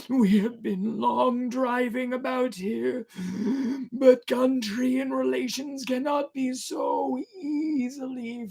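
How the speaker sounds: drawn-out wailing sailor; eerie stranger. Acted. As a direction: phantom seaman pleading to the crew. Ghost sailor pleading.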